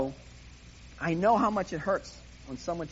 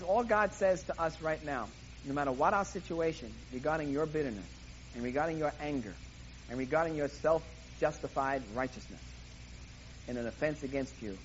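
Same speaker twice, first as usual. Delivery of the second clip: ordinary talk, nothing marked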